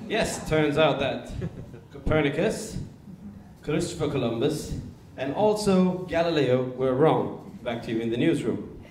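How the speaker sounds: British newsreader accent, emphatic key words. Shown as a demonstration. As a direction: deliver it like a British news report. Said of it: "got to be a British accent".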